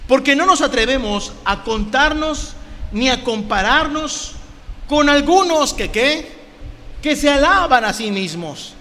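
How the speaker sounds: high, slow, drawn-out Bible verse; sarcastic. Acted. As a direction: read the verse with pointed sarcasm. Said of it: "está hablando con un tono de sarcasmo".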